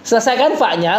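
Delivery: ordinary delivery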